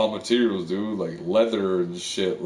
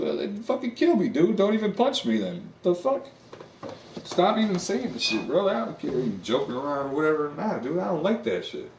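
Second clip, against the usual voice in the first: retold heated reply; 9 semitones higher than usual. Retold argument with Mike.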